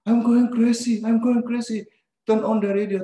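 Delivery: high, loud panicked pleading; frantic panic. Retold or imitated. Passenger panicking and demanding the radio, loud frantic cries. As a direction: repeat it like a man coming apart. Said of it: "dia punya muka kayak begini".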